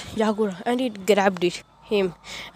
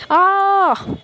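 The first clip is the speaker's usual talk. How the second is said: sudden high yelp of pain; pained cry; 9 semitones higher, 3 dB louder than usual. Acted. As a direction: cry out as the punch lands. Yagura gets punched in story.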